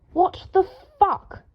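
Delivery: high, slow disgusted yell. A narrator's reading. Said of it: "Draco yelled".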